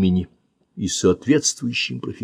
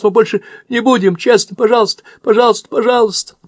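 much higher, louder tearful begging, rushing; desperate wailing; 13 semitones higher, 5 dB louder than usual. Acted. Boy begs the man to let them go, wailing. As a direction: beg through tears for release. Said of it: "завываю я".

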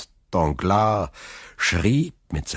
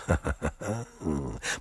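sly chuckle; 7 dB quieter than usual. Acted. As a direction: chuckle at your own idea. Villain scheming aside.

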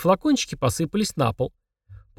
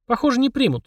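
higher start sinking into resignation; sighed answer; 10 semitones higher than usual. A narrator's reading. Boy answering his sister about their money, mild sigh. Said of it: "вздохнул Денис".

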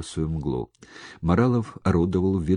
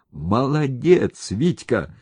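slow, raised, hearty exclamation; drawn-out, higher-pitched approval; emphatic praise; 7 semitones higher, 4 dB louder, slower than usual. A narrator's reading. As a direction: praise him like banging a fist on the table. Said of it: "трахнул кулаком по столу Моралов".

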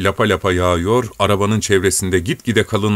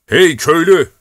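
loud, high hail; calling out; 8 semitones higher, 5 dB louder than usual. A narrator's reading. Traveller hailing a peasant in the blizzard, loud call. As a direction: shout across the snow to him.

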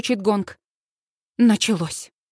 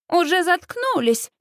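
high, taunting echo; boys' high-pitched teasing; mocking mimicry; 6 semitones higher than usual. A narrator's reading. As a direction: parrot back in a high voice. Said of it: "передразнивают они".

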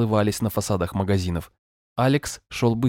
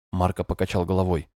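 plain reading voice

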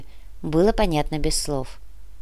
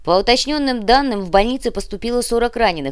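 higher, pressing newsreader voice; radio news update; 4 semitones higher than usual. Acted. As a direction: deliver the latest figures on air. Voices a third station's news.

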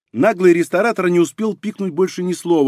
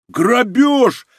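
high, raised cry of protest; outraged exclamation; 9 semitones higher, 4 dB louder than usual. A narrator's reading. Well-fed brothers protesting the price, exclaiming in outrage. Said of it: "воскликнули упитанные братья хором".

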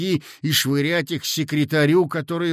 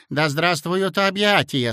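usual speaking voice

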